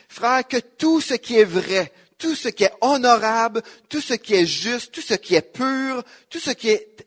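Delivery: solemn scripture reading. Acted. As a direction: proclaim the verse, leaning on every tout. Reads Philippians with emphasis.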